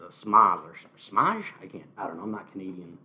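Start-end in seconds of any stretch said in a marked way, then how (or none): 0.0-1.4 schmaz said two ways, first with a falling sh, then a rising zh; trying schmaz. Shown as a demonstration. Vlogger unsure how Bret Hart says it, testing schmaz. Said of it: "that's what Bret Hart says".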